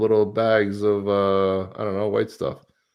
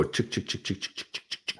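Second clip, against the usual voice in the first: calling chickens; 10 dB quieter than usual. Acted. Drawing the winner, calling chickens.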